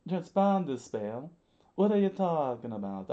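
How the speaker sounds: loud, high-voiced bewilderment; baffled character. Mister Three acted, baffled by boss.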